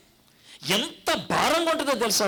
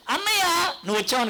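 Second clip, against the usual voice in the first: loud call; 9 semitones higher, faster than usual. Retold or imitated. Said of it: "గట్టిగా అరుస్తుంది".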